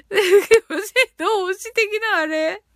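slow, laughing, puzzled question; drawn-out amused repeat; laughing disbelief. Real, not acted.

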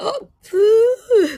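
high, loud startled "hot!" with a hiss; hot reaction. Retold or imitated. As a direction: re-enact the shock of touching a burning forehead. Feverish child touched, retold. (熱さに驚いた高く大きな声で)

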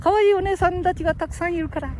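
high-pitched put-on character voice; high, small-voiced caution; mock warning. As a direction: explain it in a high puppet voice. Acts out older man's warning.

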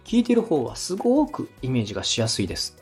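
ordinary talk

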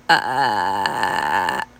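very low creaky fry; edge voice. Shown as a demonstration. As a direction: crackle out a low ah. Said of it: "これがエッジボイスというやつです". (低いエッジボイス)

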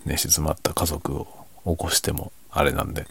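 usual speaking voice

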